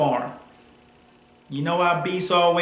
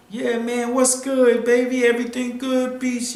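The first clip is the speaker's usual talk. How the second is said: higher, chummy greeting; butter him up like a buddy; hammed-up friendly hello; 7 semitones higher than usual. Rock runs same greeting game.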